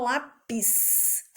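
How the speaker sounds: lápis said with the final s drawn out softly. Shown as a demonstration. Teacher showing children the last sound of lápis. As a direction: let the s at the end of lápis hiss out. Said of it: "faz justamente o sonzinho final".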